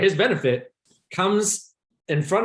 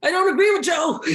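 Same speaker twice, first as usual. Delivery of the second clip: mock panic; 13 semitones higher, faster than usual. Mock panic at imagined police.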